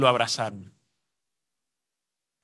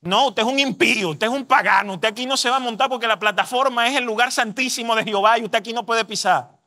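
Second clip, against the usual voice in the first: higher-pitched parody of a pious gatekeeper; mock indignant refusal; 4 semitones higher than usual. Imitating church members barring an unbaptized man from the platform, mock indignation. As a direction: imitate a self-righteous scolding, pressing on without letup.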